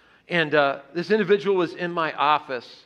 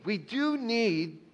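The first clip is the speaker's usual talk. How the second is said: soft slow repeat; 5 semitones higher, 7 dB quieter, much slower than usual. Real, not acted. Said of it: "repeated quietly, slowly".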